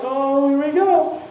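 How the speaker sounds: loud, high, drawn-out exclamation; mock dread. Boyfriend watching the fight start, loud mock dread. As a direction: stretch it out as trouble kicks off.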